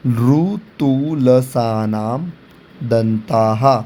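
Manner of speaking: ऋतुलसानाम drawn out very slowly, each syllable separate, then दंताः; सूत्र को धीरे-धीरे अक्षर-अक्षर बोलना. Shown as a demonstration. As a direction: spell out ऋतुलसानाम slowly for students. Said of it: "इसका भी एक सूत्र दिया हुआ है".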